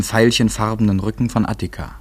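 usual speaking voice

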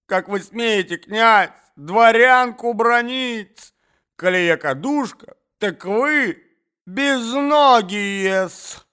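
высокий громкий визг негодования; обрушься на князя с визгом; визгливая брань. Acted. Дама бранит князя. (обиженная дворянка визгливо бранит князя)

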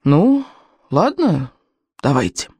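hesitant agreement with long pauses; halting, pitch sinking word by word; slow, reluctant. A narrator's reading. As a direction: give in one word at a time. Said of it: "он медленно произнёс".

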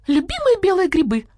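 high squawky copy of her voice; mimicking the woman. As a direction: parrot her wail, high up. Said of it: "голосом Нины Павловны". (высоко передразнивая хозяйку)

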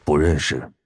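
low, curt refusal; low man's voice. Acted. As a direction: brush it off in a deep voice. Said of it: "安德烈摇摇头".

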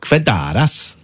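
Kvedaras, stress on the second syllable; Lithuanian name. Shown as a demonstration. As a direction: say Kvedaras slowly, rising at the end. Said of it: "got the intonation on the surname right".

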